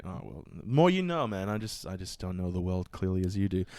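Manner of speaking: ordinary delivery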